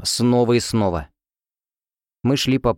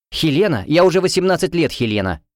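flaring up; 7 semitones higher than usual. Acted. Roommate snapping about her own name, indignant. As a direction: flare up, stung and exasperated. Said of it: "вспылила она".

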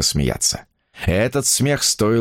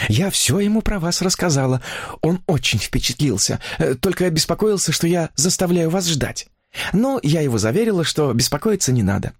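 higher-pitched chattering heroine; infatuated young woman; 9 semitones higher than usual. A narrator's reading. Woman confiding her new romance to a writer, higher enraptured voice.

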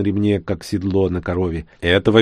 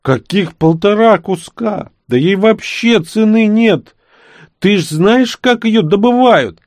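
high, loud, slow outrage; indignant; 12 semitones higher, 5 dB louder than usual. Acted. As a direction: protest hotly, voice up high. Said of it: "возмутился Момент".

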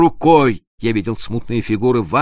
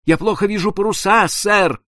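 высоко, с дрожью в голосе; испуганный рулевой плохо видит паруса; дрожащий голос; 8 semitones higher than usual. Acted. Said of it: "странным дрожащим голосом". (рулевой отвечает капитану дрожащим голосом)

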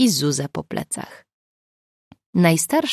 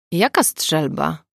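slow, wary question; suspicious; slower than usual. A narrator's reading. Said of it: "zapytał podejrzliwie Mateusz". (podejrzliwie, powoli)

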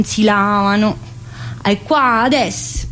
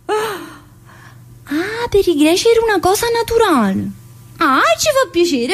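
mock teacher voice; 8 semitones higher than usual. Mocking the teacher's reply.

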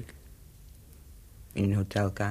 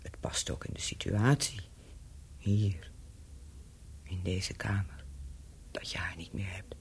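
low, secretive whisper; knowing whisper; 5 semitones lower, 8 dB quieter than usual. Acted. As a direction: lean in and whisper it. Claire confides knowingly to Bobby.

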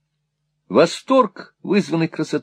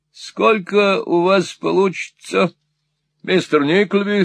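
slow, higher-pitched clerk's voice; lightly voiced unhurried clerk, unbothered; coolly unconcerned clerk; 7 semitones higher, slower than usual. A narrator's reading. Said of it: "раздражающе хладнокровный".

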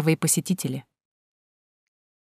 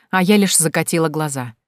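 ordinary delivery

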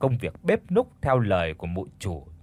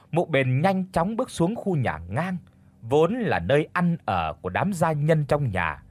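usual speaking voice

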